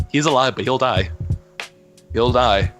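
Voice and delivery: usual speaking voice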